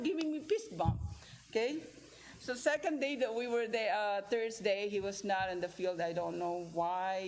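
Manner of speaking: usual speaking voice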